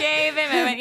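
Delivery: high, loud impatient driver; mock road rage. Alone in the car at traffic, mock impatient shout. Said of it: "when I'm in my car alone".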